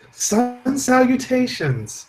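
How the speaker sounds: drawn-out falsetto salutations; sing-song high and slow; high slow mock greeting. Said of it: "stop talking like we're bitches".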